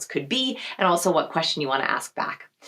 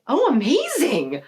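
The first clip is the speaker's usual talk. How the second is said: excited reaction; 5 semitones higher, much slower than usual. Retold or imitated. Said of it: "They're like".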